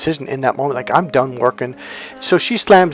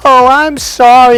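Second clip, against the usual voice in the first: much higher, louder, slow and sing-song put-on girl voice; girly apology; 11 semitones higher, 10 dB louder, slower than usual. Blonde woman apologising to him after bumping him, high and sing-song. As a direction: play the bubbly blonde who bumped into him on purpose.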